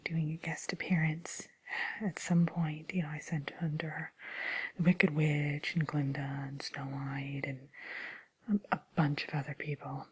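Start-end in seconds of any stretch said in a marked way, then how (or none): none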